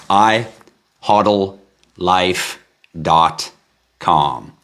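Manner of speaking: each word set apart with long pauses; "I... hodl... life... dot... com", deliberately spaced. Real, not acted. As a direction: spell out the address slowly, one word at a time. Repeats address word by word.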